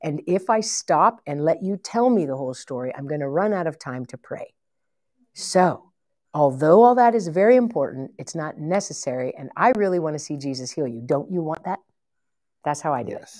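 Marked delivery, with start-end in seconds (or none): 0.0-11.8 said really lovingly. Shown as a demonstration. Demonstrating to the team how to gently refuse a sob story. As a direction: let them down kindly but firmly. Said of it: "here's what I say really lovingly".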